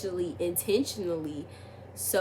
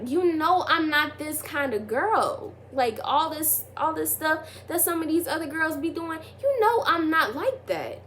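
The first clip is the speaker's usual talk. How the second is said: higher, slowed indignant plea; indignant insistence; 8 semitones higher than usual. Retold or imitated. Telling the boy she's not like other girls, high and indignant. Insisting to him in retold text.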